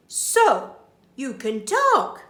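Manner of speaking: put-on a burglar marvelling at the baby; Burglar Bill voice, pleased. Burglar speaks to baby, delighted surprise. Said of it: "he says".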